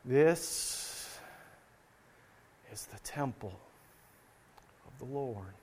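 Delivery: hushed, slow and low, long reverent pauses; very solemn. Shown as a demonstration. Preacher imitating worshippers repeating the temple phrase, very solemn. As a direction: drop to a low, solemn near-whisper, spaced out. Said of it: "or maybe very solemnly".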